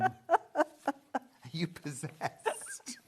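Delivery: normal talking voice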